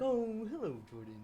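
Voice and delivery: high, sing-song church lady greeting; imitating Marquise. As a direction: mimic a sweet Southern Baptist lady. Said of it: "he'd go like".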